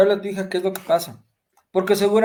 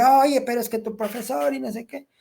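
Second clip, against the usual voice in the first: higher-pitched acted reproach; mock parental reproach; 7 semitones higher than usual. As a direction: imitate a parent jumping to complain. Parent wrongly confronting child over complaint.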